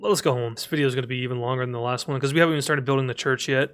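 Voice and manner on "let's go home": ordinary talk, nothing marked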